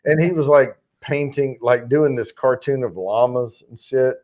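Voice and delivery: ordinary delivery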